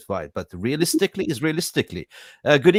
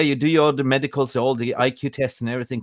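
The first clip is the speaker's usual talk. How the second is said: normal talking voice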